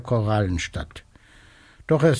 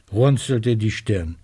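usual speaking voice

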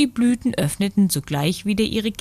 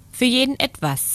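prompt, higher-pitched answer; quick reply; 7 semitones higher, faster than usual. A narrator's reading. Little sunbeam offering the cloud a compromise, quick reply. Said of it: "sagte der kleine Sonnenstrahl schnell".

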